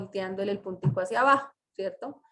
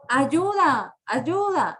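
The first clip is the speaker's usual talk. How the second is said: high, loud call for help; exclaimed cry for help; 5 semitones higher, 6 dB louder, much slower than usual. Shown as a demonstration. Teacher demonstrating Pepita's exclamation, a loud cry. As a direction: cry out Ayuda like Pepita in trouble. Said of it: "hacemos la entonación".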